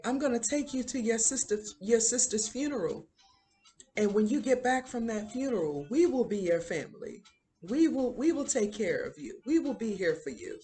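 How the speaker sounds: high, insistent imitated call; retold yell. Schoolmaster promising rowing boy a family, retold high call. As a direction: pitch it up like calling across water. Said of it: "He's yelling at him".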